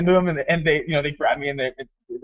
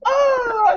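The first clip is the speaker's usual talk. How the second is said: very high mock scream; hammed-up cry of being shot; imitated death shriek. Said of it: "She goes".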